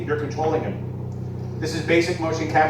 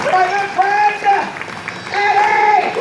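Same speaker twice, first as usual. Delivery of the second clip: announcer shout; 8 dB louder, slower than usual. Acted. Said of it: "announcer hypes the puppet".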